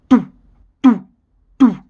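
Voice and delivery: low thudding giant footsteps, "doo"; three short, separated thumps; thumping noise. A narrator's reading. Telling children of the loud thumping Jack heard, imitated thuds. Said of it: "heard a loud thumping noise".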